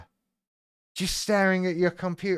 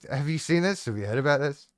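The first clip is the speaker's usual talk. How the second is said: ordinary delivery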